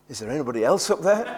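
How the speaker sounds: quick punchline. Retold or imitated. Punchline of joke.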